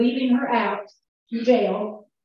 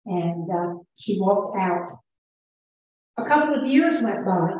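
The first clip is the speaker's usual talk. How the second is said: usual speaking voice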